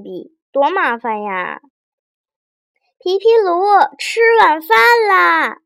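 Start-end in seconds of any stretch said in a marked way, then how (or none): 3.0-5.6 high, loud call across the house; mother calling. A narrator's reading. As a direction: call the child to dinner from another room. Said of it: "妈妈在饭厅喊皮皮鲁". (妈妈在饭厅高声喊孩子吃饭)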